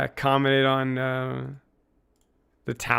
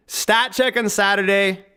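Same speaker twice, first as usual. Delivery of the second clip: hype call; 7 semitones higher, 4 dB louder than usual. Acted. Sign-off hype line.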